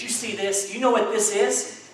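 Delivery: mildly indignant official showing off authority; a touch of puffed-up indignation. Acted. Federal agent shows the rancher his badge, mildly indignant. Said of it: "federal agent gets all indignant".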